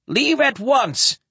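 high, drawn-out alarmed command; ordering. Acted. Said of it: "he ordered".

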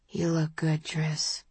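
low-volume, intimate murmur; close in the ear. A narrator's reading. Tipsy compliment close to Tris's ear, softly murmured. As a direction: say it softly, for one listener only. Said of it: "puts his lips next to my ear and says".

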